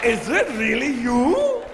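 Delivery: high, loud, thrilled Indian fan; excited shout. Retold or imitated. Agent recognises the famous comedian and shouts with excitement in an Indian accent.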